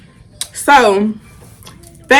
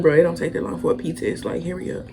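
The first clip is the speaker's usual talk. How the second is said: muttered under the breath, much softer; impatient mutter; 3 semitones lower, 12 dB quieter, faster than usual. Retold or imitated. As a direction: grumble it so the doctor can't hear. Impatient aside to the doctor.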